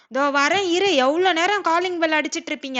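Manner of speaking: loud impatient call toward the door. Acted. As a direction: shout it across the house, annoyed. Mother calling out to the door.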